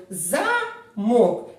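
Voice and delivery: «замок» spoken in two parts, first syllable «за» held out; stress demo. Shown as a demonstration. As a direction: separate and stretch «за» in «замок». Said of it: "я говорю". (чітко виділити «за» у слові «замок»)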